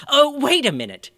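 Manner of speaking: high, loud sudden alarm; surprised. A narrator's reading. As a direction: stop yourself in a startled rush. Mayor catches his mistake.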